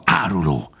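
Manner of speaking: restrained, venomous fox; hissed accusation. A narrator's reading. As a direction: keep the insult down, bitter. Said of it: "sziszegte a róka". (sziszegve vádolva)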